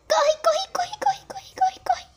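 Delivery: high urgent whispered run; whisper-squeal in panic; panicked whisper. Real, not acted. Urging self to run.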